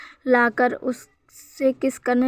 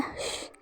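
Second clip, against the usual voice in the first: a breathy shh to hush him; shushing softly to calm a crying friend; 19 dB quieter, much slower than usual. Acted. Hushing crying Wing.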